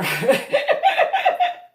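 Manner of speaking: high, loud laughing squeal. Real, not acted. Loud high squeal at the husband's remark.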